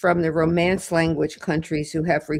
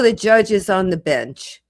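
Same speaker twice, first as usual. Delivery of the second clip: ordinary talk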